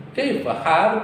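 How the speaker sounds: "Kaifa hal?" with the voice going up at the end; Arabic question demo with an upward ending; rising tune. Shown as a demonstration. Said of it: "Ke atas".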